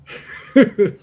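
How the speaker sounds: a short burst of real laughter; a quick, amused laugh breaking out; rueful laugh. Real, not acted. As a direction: laugh it off, high and bright. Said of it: "Now, I have to laugh".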